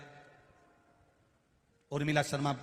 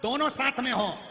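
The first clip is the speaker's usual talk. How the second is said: impassioned preaching; 9 semitones higher, 7 dB louder than usual. Acted. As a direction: stretch each word out to the hall. Impassioned sermon climax.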